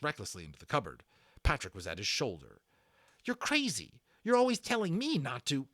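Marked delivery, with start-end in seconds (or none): 3.2-5.7 high, rising alarm; anxious boy protesting. A narrator's reading. Patrick protests, much higher.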